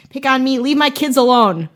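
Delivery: hammed-up Wisconsin dad yelling at a ghost; mock Wisconsin accent shout. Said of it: "a Wisconsin accent where it's like".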